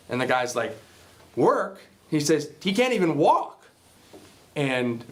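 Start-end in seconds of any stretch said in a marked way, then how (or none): 1.3-1.8 higher-pitched questioning echo; retold incredulous query, voice rising; mock incredulity. Boss scoffing at the workers' refusal, mildly incredulous. 2.6-3.6 higher-pitched, pitch climbing at the end; retold indignant protest. Worker protesting to the boss about Terry, retold indignantly.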